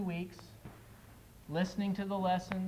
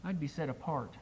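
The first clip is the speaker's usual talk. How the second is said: a preacher reciting a poem with loud, pleading climaxes; dramatic poem recital; 6 dB louder than usual. Acted. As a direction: perform the poem like a heartfelt altar call.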